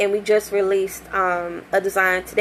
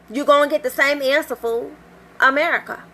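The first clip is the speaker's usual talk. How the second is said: sassy exasperation; 6 semitones higher than usual. Retold or imitated. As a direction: tell him off with attitude. Fed up with customer.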